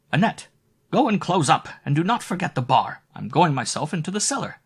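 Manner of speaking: put-on high, loud commanding old woman; bossy orders. A narrator's reading. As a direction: bark orders across the inn. Landlady orders the maid.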